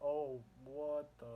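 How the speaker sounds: slow, drawn-out stunned "Oh, what the"; surprised Batman. Retold or imitated. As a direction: let the surprise stretch out slowly. Batman seeing the helicopter.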